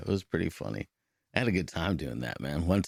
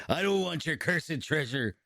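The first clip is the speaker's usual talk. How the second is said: much higher, hammed-up indignant pirate; 8 semitones higher than usual. Pranking the phone saleswoman as an indignant pirate, hammed-up and much higher. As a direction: scold like an offended pirate captain. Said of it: "so I was doing it as a pirate".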